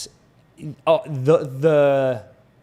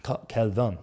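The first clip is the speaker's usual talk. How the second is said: put-on French accent; 4 semitones lower, 5 dB quieter, slower than usual. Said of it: "your French accent is horrible".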